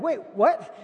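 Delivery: high, loud startled double take; surprised. Retold or imitated. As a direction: jolt back in disbelief. Shocked reaction to the news.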